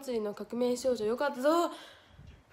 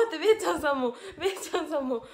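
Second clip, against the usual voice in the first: 笑い混じりの大きな声; 笑いの勢いのまま声を上げる; 笑いながら; 4 semitones higher, 7 dB louder, faster than usual. Real, not acted. (笑って大きめの声で)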